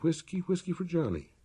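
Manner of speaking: high begging voice; pleading Johnny Bear. A narrator's reading.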